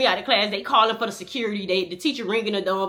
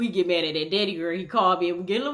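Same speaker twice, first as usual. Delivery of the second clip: ordinary delivery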